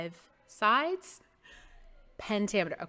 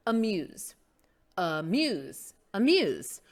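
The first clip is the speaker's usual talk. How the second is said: amuse as a-MUSE; second-syllable stress; slower than usual. Shown as a demonstration. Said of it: "No, we say amuse".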